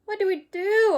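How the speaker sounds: high loud panicked wail. Acted. Arthur trapped by the skunk, loud high wail. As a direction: cry out in panic. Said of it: "Arthur wailed".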